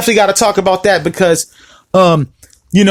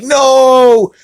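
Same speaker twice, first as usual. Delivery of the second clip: long, higher wail of defeat; retold dismayed no; 4 semitones higher than usual. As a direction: stretch it out in mock despair. Retelling reaction to losing the game.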